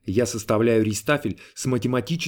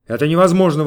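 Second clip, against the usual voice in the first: raised, emphatic refusal; objecting; 4 semitones higher, 7 dB louder, faster than usual. A narrator's reading. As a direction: object firmly and loudly. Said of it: "возразил я".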